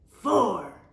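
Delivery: loud blurted answer; loud know-it-all shout. A narrator's reading. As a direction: blurt it out without raising a paw. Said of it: "shouted Camilla Capybara".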